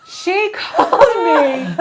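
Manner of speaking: high, loud, drawn-out laughing complaint. Real, not acted. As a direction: laugh through it, stretching the words out. Laughing over sister's many calls.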